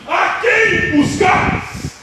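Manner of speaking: loud, high, drawn-out shout; shouted challenge. Acted. Jesus calls out to the armed men coming, shouting. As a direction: call out slowly to a whole crowd. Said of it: "Jesus gritando para os homens".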